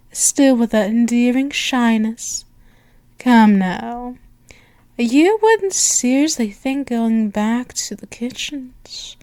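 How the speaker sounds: normal talking voice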